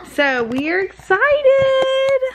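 slow, swooping high on the last word. Real, not acted. Mom hyping baby for helmet-free day, excited baby talk.

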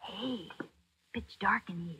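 soft whisper in the dark; hushed whisper. Acted. Patsy creeping into a dark hospital room, whispering. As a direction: breathe it so nobody hears.

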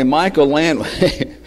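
ordinary delivery

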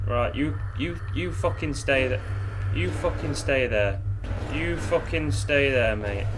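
low, soft, slow warning; wary warning. Real, not acted. Player telling the creature to stay, in a low wary voice. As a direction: keep it low, warily holding the threat off.

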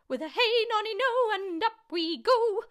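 put-on high, loud, screechy old woman; gleeful shout. A narrator's reading. Grandma shoots up through the house, gleeful screechy shout. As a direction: shout it out, stretching the end. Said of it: "she shouted".

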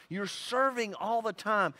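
ordinary talk

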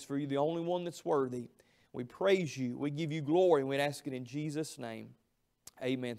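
low, hushed reverent prayer. Acted. Pastor praying for the church tonight, low and reverent.

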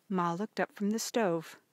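plain reading voice

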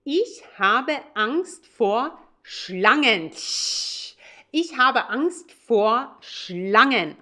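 "Ich habe Angst vor Schlangen" spoken slowly and clearly, each word separate; careful, slow teaching pronunciation; slow model German. Shown as a demonstration. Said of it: "You would say".

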